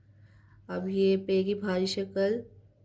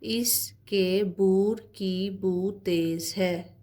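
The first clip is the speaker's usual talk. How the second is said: very slow, drawn-out sentence reading; slow dictation reading; slower than usual. Acted. Teacher reading a practice sentence to her class, very slow. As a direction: stretch every word so children can follow.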